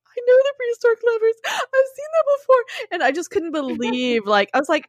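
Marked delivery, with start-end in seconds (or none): 0.1-2.6 excited. Retold or imitated. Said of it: "I'm like".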